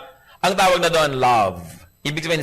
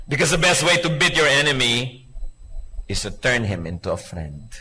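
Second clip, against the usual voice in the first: sermon maxim; 3 semitones lower than usual. Acted. Preaching maxim.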